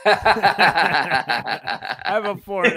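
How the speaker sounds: laughing loudly through the words, drawn out slowly. Real, not acted. Dad laughing about his young kids, loud and slow. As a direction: let the laugh carry the whole line.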